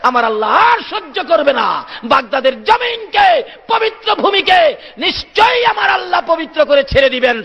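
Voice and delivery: high, impassioned sermon cry; full-force preaching. Real, not acted. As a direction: build to a ringing, shouted declaration. (জোরালো উচ্চকণ্ঠে আবেগী ওয়াজ)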